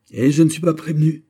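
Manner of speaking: high, loud cry of royal offence; offended outcry. A narrator's reading. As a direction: flare up at the insult. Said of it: "s'écria le roi".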